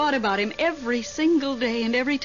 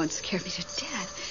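frightened breathless whisper, much softer; scared whisper; 6 semitones lower, 10 dB quieter than usual. Acted. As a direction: whisper it as if the noise might hear you. Frightened by a clanking noise.

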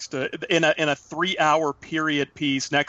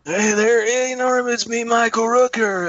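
high, loud, slow raspy mock Rooker; Michael Rooker impression; 7 semitones higher, 4 dB louder, slower than usual. Mocking Michael Rooker's voice to his co-host, higher, louder and slow. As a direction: ham up a slow, raspy tough-guy greeting. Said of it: "That's how he talks".

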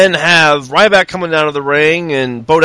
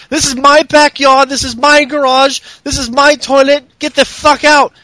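mock Paige; 8 semitones higher than usual. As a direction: puff up and claim everything. Mocking Paige's promo.

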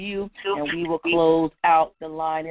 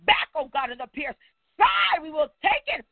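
higher-pitched, urgent prayer cadence; impassioned prayer; 6 semitones higher than usual. Real, not acted. Preacher surrendering to God before the congregation, impassioned prayer. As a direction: cry out to God in swelling phrases.

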